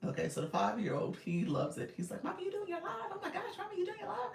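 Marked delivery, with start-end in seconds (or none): 2.2-4.3 put-on high, squeaky little-kid voice, giggly; chipmunk-voiced child pleading; little son's chipmunk voice. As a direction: imitate an eager five-year-old begging to join. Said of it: "you hear his little chipmunk voice".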